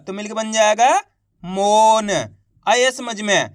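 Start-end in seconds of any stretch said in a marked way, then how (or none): 1.4-2.1 मौन with a long held vowel and a light final न; half final letter. Shown as a demonstration. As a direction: stretch मौन, releasing only half the last letter. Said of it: "last वाले अक्षर का उच्चारण आपको आधा करना". (मौन को खींचकर, आखिरी न आधा)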